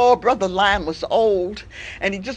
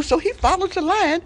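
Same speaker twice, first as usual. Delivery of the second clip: usual speaking voice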